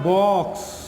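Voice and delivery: 'बॉक्स' drawn out to show its final ks; teacher sounding out 'बॉक्स'; example word sounded out. Shown as a demonstration. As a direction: stretch 'बॉक्स' and let the ks ring. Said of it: "तो क्या आ रहा है".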